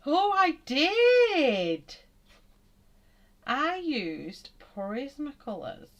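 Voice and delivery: high, loud, slow "oh, I did!"; sudden realisation. Real, not acted. Realising mid-drawing which pencils she used, high and surprised. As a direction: stretch it out as the memory lands.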